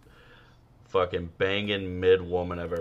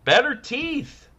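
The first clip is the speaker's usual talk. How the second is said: better teeth jumps much higher and slower; squeaky emphasis; slower than usual. Shown as a demonstration. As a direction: stretch better teeth up high and slow. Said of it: "repeated phrase".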